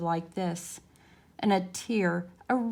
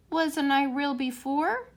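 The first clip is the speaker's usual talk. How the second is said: little rabbit wondering; 6 semitones higher, slower than usual. A narrator's reading. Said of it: "asked the little rabbit".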